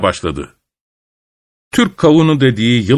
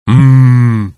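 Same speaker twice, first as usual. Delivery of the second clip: bear growl; 5 dB louder than usual. A narrator's reading. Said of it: "diye homurdandı ayı".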